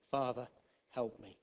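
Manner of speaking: soft whispered-style appeal to God; quiet prayer. Pretend. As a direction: pray it alone in a closet. Prayer in the closet.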